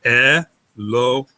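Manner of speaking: "Elohim" slowly split as E, lo, him; syllable by syllable. Shown as a demonstration. Teacher showing open and closed syllables, slowly split. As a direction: sound out "Elohim" with gaps between syllables. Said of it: "So it's open syllable".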